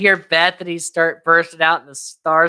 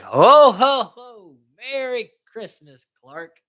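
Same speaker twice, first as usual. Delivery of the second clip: mock Santa greeting; 6 semitones higher, 5 dB louder, slower than usual. Eddie's Santa greeting, retold.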